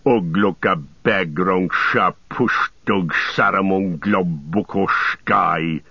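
slow, snarled curse in orc tongue; angry orc speech. A narrator's reading. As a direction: spit out a drawn-out foreign curse. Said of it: "angry speech in his own tongue".

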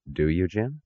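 soft, restrained challenge; very quiet. A narrator's reading. Eddie answering Taggart's outburst, very quiet. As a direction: ask it softly, rising at the end. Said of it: "a very quiet tone".

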